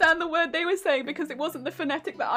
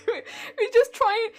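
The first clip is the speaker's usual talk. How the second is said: laughing; 6 semitones higher than usual. Real, not acted. Still laughing.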